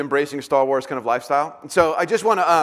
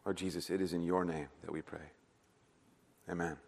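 soft prayer; 8 semitones lower, 6 dB quieter than usual. Real, not acted. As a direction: pray softly and reverently.

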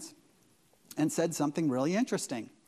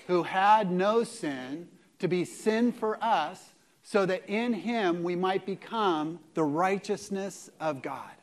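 slow reading; slower than usual. Shown as a demonstration. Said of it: "let's go together".

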